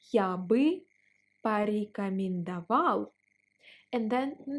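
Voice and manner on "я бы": ordinary delivery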